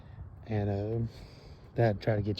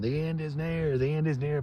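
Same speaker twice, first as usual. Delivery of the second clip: high-pitched mock street-corner prophet proclaiming; a lightly hammed-up end-of-the-world shout; street doomsday preacher; 5 semitones higher than usual. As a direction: cry it out like a preacher in Times Square. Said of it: "in the stand going".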